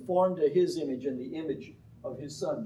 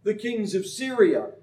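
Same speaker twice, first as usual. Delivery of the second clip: slow, higher, louder grand declaration; bold prophecy; 8 semitones higher, 7 dB louder, slower than usual. Retold or imitated. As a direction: proclaim it like a showy prophet. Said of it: "and he says".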